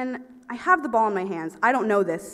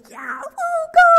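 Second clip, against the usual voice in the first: high, loud woo; mock cheer; 10 dB louder than usual. Cheering the team from the bench, high and squealy.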